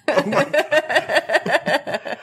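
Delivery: high, drawn-out laughing. Real, not acted. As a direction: laugh it out, very slow. Laughing after sexy joke.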